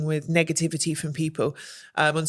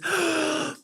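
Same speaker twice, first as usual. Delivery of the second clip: gasp; 9 semitones higher, 4 dB quieter than usual. Retold or imitated. Everyone looked at her.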